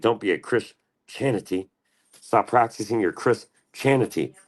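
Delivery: "Christianity" split slowly as Chris-Chanity, high; mocking pun. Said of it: "I was making fun of".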